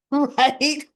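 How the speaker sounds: amused laugh, asking agreement. Real, not acted. Recap host laughing at the captor's logic, amused. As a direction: laugh through "Right?". Laughing at her own retelling.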